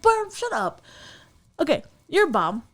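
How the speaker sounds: high, mock-exasperated brush-off; exasperated scolding. Host answering the guy's retold excuse, exasperated. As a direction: cut him off in a high, fed-up voice.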